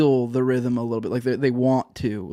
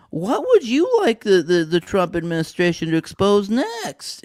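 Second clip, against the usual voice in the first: mock tweet voice; 5 semitones higher, slower than usual. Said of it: "they tweet out something that's like".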